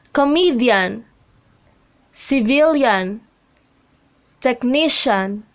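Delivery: coMEdian, ciVILian, techNIcian, second syllable stressed; stress before suffix. Shown as a demonstration. Teacher reads examples comedian, civilian, technician, each stressed before the suffix. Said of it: "on a syllable right before the suffix".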